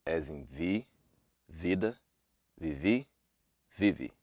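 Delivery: vi, vida, vivi, vive with a clear tense i; crisp Portuguese i vowel; Portuguese i demo. Shown as a demonstration. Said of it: "This sound happens in stressed I".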